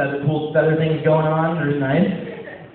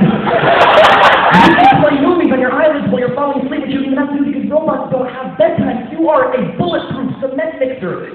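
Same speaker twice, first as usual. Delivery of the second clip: excited hype; 7 semitones higher, 6 dB louder than usual. Acted. Coach hype robot pitch.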